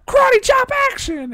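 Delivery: very high, loud toy announcer shriek; toy voice box. Retold or imitated. Commercial toy's voice box squealing its catchphrase, very high and loud. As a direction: squeal it like a cheap talking toy.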